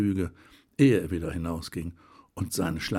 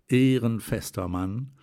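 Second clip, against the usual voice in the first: plain reading voice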